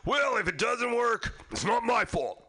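high, slow, careless mock friend; hammed-up carefree skydiver; mock shrug. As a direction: shrug it off lazily. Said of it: "He's like".

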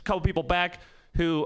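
ordinary talk

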